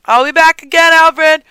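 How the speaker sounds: loud call to the butler. Pretend. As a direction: call it over your shoulder. Calling to butler while leaving.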